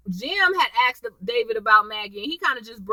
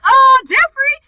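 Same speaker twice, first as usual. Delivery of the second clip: loud, high mock dismay; parents' shocked reaction; 13 semitones higher, 10 dB louder, slower than usual. Parents calling out their son, mock dismay. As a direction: exclaim it like an aghast parent.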